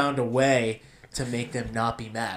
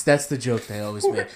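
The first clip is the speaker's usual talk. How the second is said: normal talking voice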